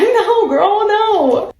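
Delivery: high, drawn sassy refusal; high-pitched sassy no girl. Retold or imitated. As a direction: shut her down with attitude.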